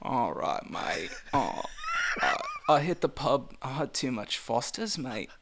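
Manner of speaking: soft, put-on Australian with a cold; Australian accent. Shown as a demonstration. Asked to fake a cold as an Australian, hammed-up accent. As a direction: play a sick Aussie bloke, quietly. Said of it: "pretend you have a cold in an Australian accent".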